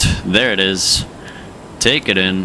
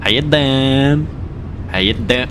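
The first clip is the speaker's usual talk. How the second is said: put-on New York accent; 5 semitones higher than usual. Streamer greeting the city on arrival, New York accent. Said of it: "Time for the New York accent".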